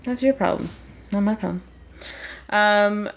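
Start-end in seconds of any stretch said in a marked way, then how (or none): none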